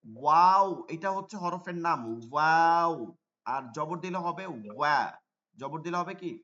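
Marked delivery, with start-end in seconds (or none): 0.1-2.9 ওয়াও with lips pushed round and forward. Shown as a demonstration. Teacher models the letter name, lips rounded. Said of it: "ঠোঁট গোল করে".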